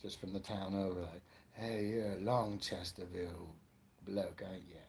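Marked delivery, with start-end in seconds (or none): 1.5-4.8 put-on low, lightly done English accent; mock English accent. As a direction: put on a bit of an English local. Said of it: "in England, where they can tell the accents".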